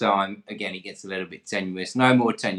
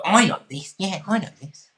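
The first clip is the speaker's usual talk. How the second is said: high, loud mock excitement; pitched-up burst of eager recognition; excited contestant; 10 semitones higher, 6 dB louder than usual. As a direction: imitate someone thrilled to know the answer. Voicing an excited quiz contestant.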